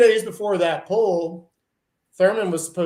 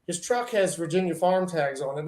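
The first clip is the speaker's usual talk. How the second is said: ordinary talk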